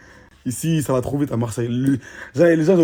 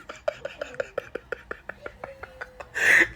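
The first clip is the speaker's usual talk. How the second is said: high breathy laugh; 8 dB quieter than usual. Real, not acted. Friend's story cracks him up.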